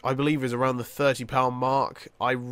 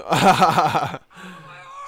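loud rapid laugh; burst of laughter; 5 dB louder than usual. Real, not acted. Gamer cut off by a game mishap, bursting out laughing. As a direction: crack up loudly.

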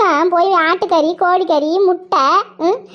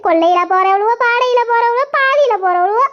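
high keening village lament with a sob; a hammed-up oppari cry of grief; wailing lament; 6 semitones higher than usual. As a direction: wail the curses like a funeral dirge. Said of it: "இந்த மாதிரி ஒப்பாரி வைக்குது இந்த அக்கா".

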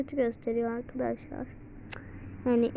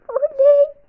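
high, loud yay; excited cheer; 11 dB louder than usual. Real, not acted. Celebrating the purchase, cheering excitedly. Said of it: "Çok heyecanlandım ya".

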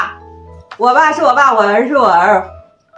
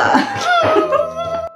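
high burst of laughter; 4 dB quieter than usual. Real, not acted. Cracks up after the twister.

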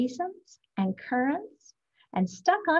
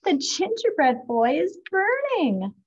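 higher, louder alarm over the oven; alarmed cry; 6 semitones higher, 5 dB louder than usual. A narrator's reading. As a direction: sound the alarm, burning!. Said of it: "sniffing the air".

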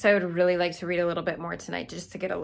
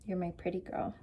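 pet talk; 9 dB quieter than usual. Real, not acted. Talking sweetly to her pet.

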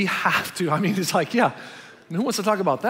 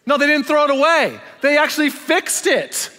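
high and loud, nearly shouting it; emphatic reveal; 9 semitones higher, 6 dB louder than usual. Real, not acted. Pastor revealing to the congregation that people fixed things. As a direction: land the surprise with full force.

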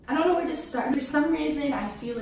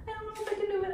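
panicky whine; 11 semitones higher, 4 dB quieter, much faster than usual. Real, not acted. Panicking before pulling strip.

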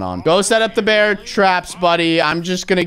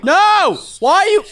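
exasperated cry; 4 dB louder than usual. Real, not acted. Bully steals sticks.

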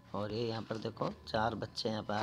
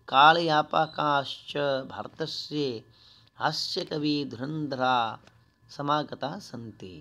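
slow, much louder declaiming; loud Sanskrit recitation; 2 semitones higher, 7 dB louder, slower than usual. Shown as a demonstration. Teacher declaiming the Sanskrit passage to pupils, slow and loud. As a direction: proclaim the line like an announcer. Reads Sanskrit text aloud.

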